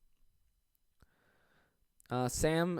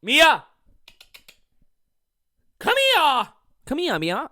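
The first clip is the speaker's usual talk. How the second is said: much higher, loud call across the room; 11 semitones higher, 10 dB louder, slower than usual. Real, not acted. Calling his cat Mia over, loud. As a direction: shout for the cat to come.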